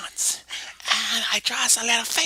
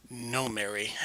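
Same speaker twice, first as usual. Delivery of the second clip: Jimmy voice; 12 semitones lower, 10 dB quieter, slower than usual. Acted. Jimmy refuses, low.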